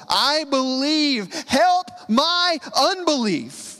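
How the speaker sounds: a desperate father crying to Jesus; cry from the soul. Shown as a demonstration. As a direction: cry it out from the depths. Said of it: "this cry from the soul".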